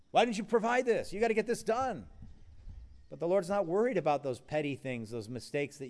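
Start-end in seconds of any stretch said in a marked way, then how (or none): 0.1-2.1 mock demanding boss. Mocking a demanding person.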